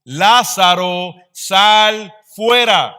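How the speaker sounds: slow, high, full-voiced shout; booming call, each word drawn out; loud commanding cry. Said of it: "gritó con fuerte voz".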